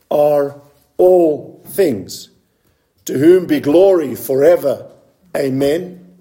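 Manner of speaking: slow, weighty reading with long pauses; scripture reading. Acted. Reads the verse aloud.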